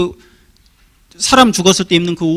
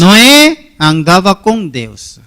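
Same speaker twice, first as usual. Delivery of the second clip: each word drawn out for the congregation; slow recitation; 3 semitones lower, much slower than usual. Shown as a demonstration. Pastor teaching the congregation a memory phrase, slow and deliberate. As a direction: spell it out slowly so they can memorize it. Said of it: "이거 기억해야 됩니다".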